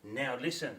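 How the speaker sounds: ordinary talk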